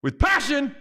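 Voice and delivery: high, emphatic coaching; Al Pacino. Retold or imitated. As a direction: urge it on, pitched high. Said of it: "And he goes".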